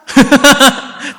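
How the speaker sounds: loud, high burst of laughter; amused laugh. Real, not acted. Storyteller tickled by the drunk man's parting words, real burst of laughter. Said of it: "되게 웃기다".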